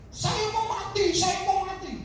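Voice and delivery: high, drawn-out mock wail; suicidal woman, mimicked. Woman threatens death before the pastor, mimicked high wail.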